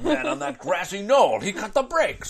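high, laughing announcement; mock news alarm. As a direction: blurt it like a breathless witness. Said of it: "laughing scene".

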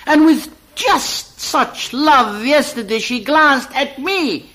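excited. Acted. Narrator urges his friend to look at her, excited laughter. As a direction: point her out in eager disbelief. Poem narrator points at woman.

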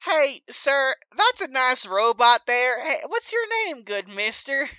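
high, emphatic character voice; big, lilting in-character introduction; Papa Scuff, overfriendly stranger. Acted. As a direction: hail a stranger with hammy friendliness.

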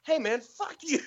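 high-pitched mock outrage, half laughing; comic indignant retort; retold angry comeback. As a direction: imitate an offended worker snapping back. Retold angry ditch digger's reply.

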